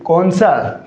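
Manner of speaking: usual speaking voice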